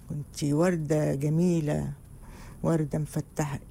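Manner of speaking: low, slow syrupy flattery; mock sweet-talk. Husband calming angry Mayar, slow low sweet-talking. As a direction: coo it slowly like a clumsy husband. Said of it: "طب اهدي بس يا ميار".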